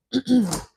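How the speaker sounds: loud, hard throat clear. Real, not acted. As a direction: clear your throat forcefully. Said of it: "let me clear my throat".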